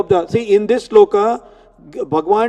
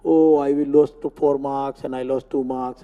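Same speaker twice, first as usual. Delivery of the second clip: mildly whiny retold lament, lower and softer; retold crying; 7 semitones lower, 7 dB quieter than usual. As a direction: imitate an upset student without real tears. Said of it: "she is crying and crying".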